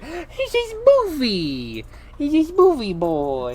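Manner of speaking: high, slow cooing; doting pet talk. Real, not acted. Reader coos over Boofy the dog, doting pet talk. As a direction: coo slowly at a beloved dog.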